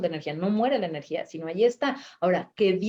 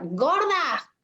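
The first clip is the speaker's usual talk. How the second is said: mock yelled insult; 8 semitones higher than usual. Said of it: "y alguien grita".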